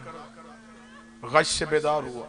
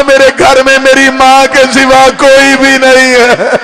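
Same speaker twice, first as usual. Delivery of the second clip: wailing lament; 6 semitones higher, 13 dB louder than usual. Acted. Preacher at the climax for the mourners, high loud wailing. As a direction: wail the words out in grief.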